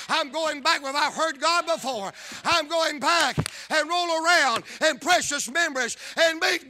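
loud, high preaching climax; preaching at full force. Acted. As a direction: shout it to the rafters like a revival preacher.

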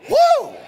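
a sudden, very high and loud "Woo!"; ecstatic woo. Real, not acted. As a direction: let out a joyous holler from the pulpit. Preacher's ecstatic outburst.

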